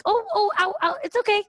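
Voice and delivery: high-pitched little ows played off as okay; feigned calm. Fun aunt to little nephew who grabbed her hair, feigned calm. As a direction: cover the hurt in a high, light voice. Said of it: "calmly just got up".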